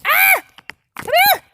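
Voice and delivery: sudden high yelp grabbing at the slipping phone; a real startled squeal; startled cry. Real, not acted. Phone slipping from her hand.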